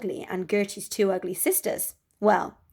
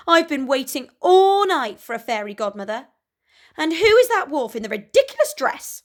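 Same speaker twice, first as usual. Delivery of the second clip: higher, louder spoilt complaint; indignant rant; 5 semitones higher, 7 dB louder than usual. Acted. Cinderella sneers at the wolf, loud and spoilt. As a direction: whine like a spoilt girl. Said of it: "she screeched".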